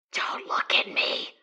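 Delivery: tense breathy whisper; frightened whisper. Acted. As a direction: whisper it, barely daring to speak. A voice whispers a warning.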